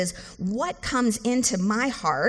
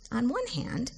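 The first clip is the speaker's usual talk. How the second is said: ordinary talk, nothing marked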